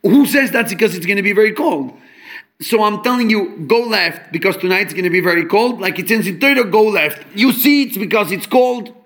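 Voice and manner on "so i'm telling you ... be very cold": normal talking voice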